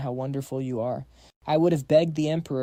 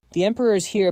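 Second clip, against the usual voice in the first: excited; 5 semitones higher, 5 dB louder, faster than usual. Acted. Eager whisper-report at door.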